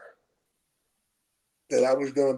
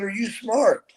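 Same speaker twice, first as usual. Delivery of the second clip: higher, slower re-asked question; repeating clearer; 6 semitones higher, slower than usual. Retold or imitated. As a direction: spell it out for the kid. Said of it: "He said".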